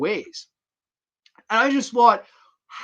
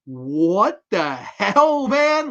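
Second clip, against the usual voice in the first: drawn-out stunned outcry; 5 semitones higher, 4 dB louder, much slower than usual. Retold or imitated. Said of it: "I was like".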